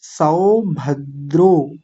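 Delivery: सौभद्रो very slowly, सौ open and द्रो closed; slow teacher demo; slow syllables. Shown as a demonstration. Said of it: "दूसरी को बोलेंगे द्रो".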